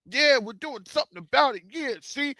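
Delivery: a high, mocking voice playing US officials; lightly voiced smug official, brushing it off. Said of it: "It's for the U S".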